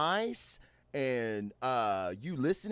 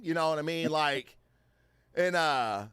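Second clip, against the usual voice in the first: normal talking voice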